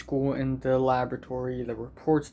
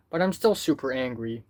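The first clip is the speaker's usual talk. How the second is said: ordinary talk, nothing marked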